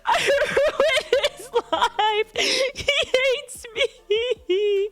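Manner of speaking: high, slow, wailing sob-talk through laughter; retold sobbing. Owner reenacting her sobbing breakdown over her dog, high tearful wail. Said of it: "start sobbing".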